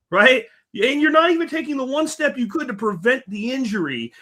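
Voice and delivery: high-pitched, exasperated retold rant; incredulous challenge. Host retelling his challenge to the tree cutter, high and incredulous. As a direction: re-enact a disbelieving scolding, pitched well up.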